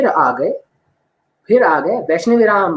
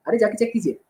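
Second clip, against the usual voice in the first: usual speaking voice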